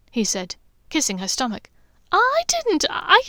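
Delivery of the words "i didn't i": high flustered stammer; high, louder flustered sputter. A narrator's reading. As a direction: trip over the words, flustered. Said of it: "her sputtering".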